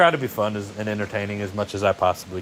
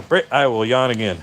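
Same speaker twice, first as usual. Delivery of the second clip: ordinary delivery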